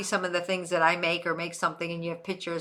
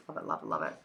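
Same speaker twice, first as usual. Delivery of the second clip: deep silly voice; 14 semitones lower, 7 dB quieter, much faster than usual. Pretend.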